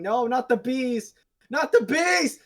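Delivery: high, loud hammed-up scream, drawn out; screaming movie impression. Friend mimicking an actor's worst movie moment, screaming. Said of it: "he keeps screaming".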